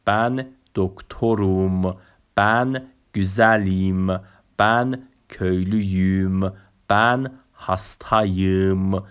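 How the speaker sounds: slow, drawn-out model pronunciation; stretched-out syllables, teacher modelling the words; pronunciation demo. Shown as a demonstration. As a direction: say each Turkish example very slowly for learners.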